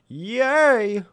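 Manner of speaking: sarcastic cheer. Acted.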